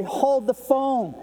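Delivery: high, drawn-out interjection; calling a halt. Acted. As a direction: stretch it out high to stop everyone.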